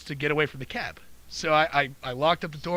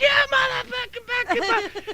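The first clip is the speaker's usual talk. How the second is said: very high, spluttering imitated rage; high-pitched caricature of swearing fury; mock furious lady. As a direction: imitate her losing it, way up high. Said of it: "just escalated and escalated this shit".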